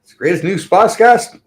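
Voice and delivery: high, loud hammed-up announcer; mock podcast intro. Host opening his podcast with a loud mock announcer voice. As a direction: belt out the show's intro like a hype host. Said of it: "how the intro goes again".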